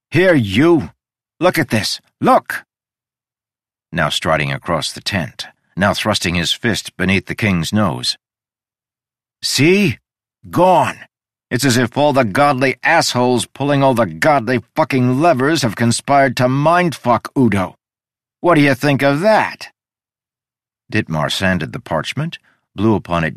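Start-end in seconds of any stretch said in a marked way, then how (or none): 0.1-2.6 excited yell. Acted. Udo yells at the king. 9.4-19.7 manic rant. Acted. Udo ranting at the king.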